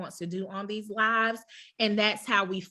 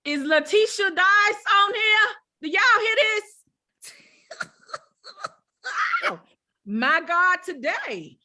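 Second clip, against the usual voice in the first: higher, louder overjoyed calling out; excited outcry; 11 semitones higher, 6 dB louder than usual. Real, not acted. Host calling out to the audience, overjoyed and laughing. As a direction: let it burst out, half laughing.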